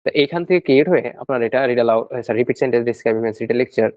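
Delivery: ordinary talk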